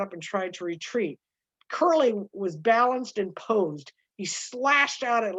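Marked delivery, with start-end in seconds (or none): none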